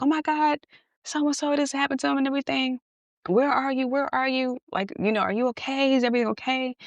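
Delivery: high, fast, breathless worry; frantic. Retold or imitated. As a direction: rattle it off, frantic. Telling his mother, then calling him.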